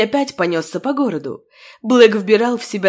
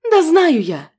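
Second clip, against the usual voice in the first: indignant, raised outburst; indignant exclaim; 7 semitones higher, 3 dB louder, slower than usual. Acted. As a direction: snap back, annoyed and hurt. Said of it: "В негодовании воскликнула Нинель".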